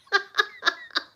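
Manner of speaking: very high squeal of laughter; real laugh. Real, not acted. Recalling being in a different world, high laughing.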